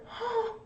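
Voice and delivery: sharp high gasp of fright; a sudden high frightened gasp; scared gasp. Shown as a demonstration. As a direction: gasp as if startled.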